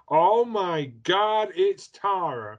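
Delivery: high, loud, long-drawn sitcom catchphrase; Janice from Friends. Retold or imitated. Teasing Tara with a sitcom impression, high and drawn out. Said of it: "Tara sounds like Janice".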